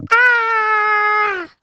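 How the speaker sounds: high, loud monster scream of fright. A narrator's reading. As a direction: shriek together in terror. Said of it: "screamed the frightening things".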